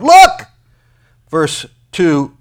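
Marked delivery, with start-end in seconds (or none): 0.0-0.5 shouted command. Acted. Preacher shouting God's command.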